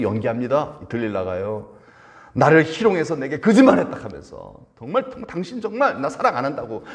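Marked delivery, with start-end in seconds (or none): 2.3-4.0 raised, louder indignant accusation; acted tantrum. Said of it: "막 승질을 내는 거예요". 4.8-6.8 high-pitched pleading whine; mock tantrum. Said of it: "막 때를 쓰고".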